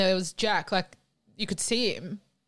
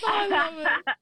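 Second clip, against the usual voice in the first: talking through real laughter, pitched up; delighted laughing comment; 10 semitones higher, slower than usual. Real, not acted. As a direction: say it while still laughing. Host laughing while talking.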